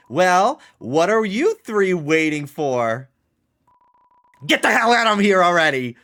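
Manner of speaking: loud, fast, exasperated yell; impatient shouting character. Acted. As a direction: shoo them out in a hurry. Voicing a gruff game character.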